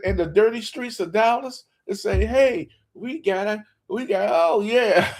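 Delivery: high-pitched, hammed-up fan glee; mock excitement. Host teasing Cowboy fans to celebrate, mock excitement. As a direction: goad them with fake elation.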